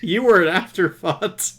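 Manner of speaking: high, loud, slow through laughter; helpless amused jab; laughing tease. Real, not acted. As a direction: tease them while cracking up. Laughing at the forgotten character.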